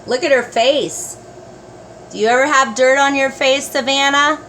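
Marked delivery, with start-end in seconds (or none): none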